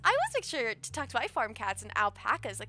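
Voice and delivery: high, lilting friendly farm neighbour; chatty farm character. Acted. Voices a chatty game character.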